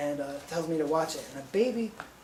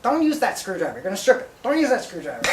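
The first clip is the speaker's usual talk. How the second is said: mocking boss; 3 semitones higher, 8 dB louder than usual.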